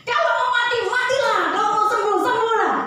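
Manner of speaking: put-on very high, loud child parroting the pastor; a kid proudly repeating the blessing; child copying the prayer.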